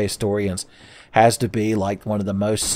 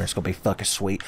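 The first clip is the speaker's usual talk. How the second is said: normal talking voice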